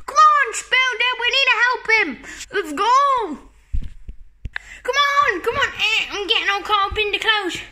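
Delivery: ordinary delivery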